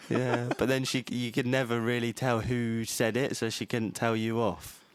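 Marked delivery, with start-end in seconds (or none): none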